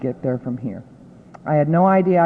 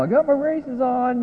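higher, joyful call upward; calling to the sky; 10 semitones higher than usual. Retold or imitated. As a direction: tell Dad the news, a little mock-triumphant. Calling up to late father.

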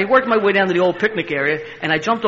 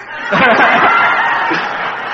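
loud unvoiced laugh; 7 semitones higher, 7 dB louder than usual. Real, not acted. Laughs at hiring anybody.

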